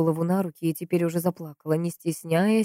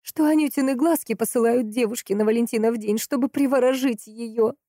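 higher woman's voice, insistent; pitched-up, affronted explanation; indignant; 6 semitones higher than usual. A narrator's reading. Valentina explains the flowers to the investigator, indignant. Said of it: "возмутилась женщина".

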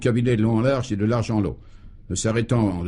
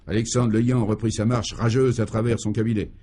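plain reading voice

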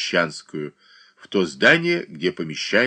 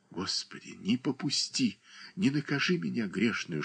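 much softer, a hushed prayer; whispered prayer; 3 semitones higher, 9 dB quieter than usual. A narrator's reading. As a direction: breathe the prayer almost silently. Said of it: "шептали её уста".

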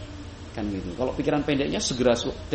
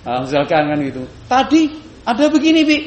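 put-on high, loud excited child calling Mum; a little kid blurting it out first; eager child voice, pitched way up and loud; small child; 11 semitones higher, 10 dB louder than usual. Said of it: "anak kita yang kecil kemudian duluan".

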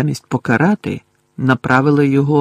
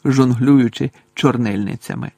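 usual speaking voice

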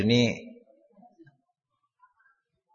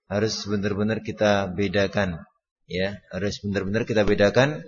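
normal talking voice

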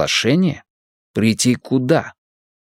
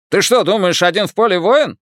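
higher, scornful Irish brogue; scoffing Irish accent; 8 semitones higher than usual. Acted. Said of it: "Ирландский акцент работал на всю катушку".

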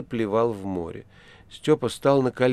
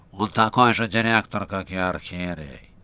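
nasal voice; 3 semitones lower than usual. A narrator's reading. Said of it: "Рыжий гнусавил всё больше и больше".

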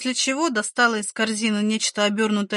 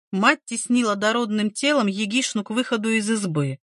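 usual speaking voice

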